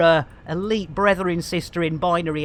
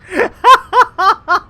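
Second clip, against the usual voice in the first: high burst of laughter; 12 semitones higher, 9 dB louder than usual. Real, not acted. Laughs at own mistake.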